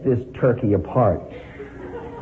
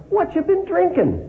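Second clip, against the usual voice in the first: put-on much higher, bright, interested old man; curious old man. A narrator's reading. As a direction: ask it with twinkling curiosity. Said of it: "his eyes sparkling with interest".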